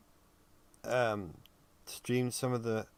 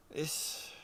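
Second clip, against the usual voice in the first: word lost in a yawn; talking through a yawn; 5 semitones higher, 4 dB quieter than usual. Real, not acted. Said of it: "the more I yawn".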